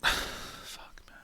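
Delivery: defeated sigh, then an unvoiced fuck; sighing whisper. Real, not acted. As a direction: breathe it out, worn down by the pick. Sighs after a tough pick.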